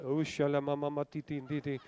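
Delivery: fast, very low and soft mock praying in tongues; parodying his own pious show. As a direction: toss off a hammed-up tongues babble.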